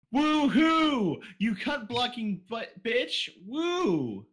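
high, loud woo-hoo; gleeful shout; cheering whoop. Real, not acted. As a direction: whoop with glee. Cheering at ghost's cock-block.

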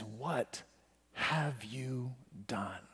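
soft, very slow, heavy pauses; hushed grave question. Acted. Preacher voices God turning to Cain, hushed and grave. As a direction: let each word sink in quietly.

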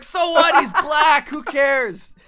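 loud, high dismissive yell; mock callous shout.

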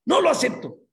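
high, loud, insistent refusal; defiant shout. Acted. Man rejecting the Angel's verdict, loud desperate defiance. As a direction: shout the refusal, hitting every word.

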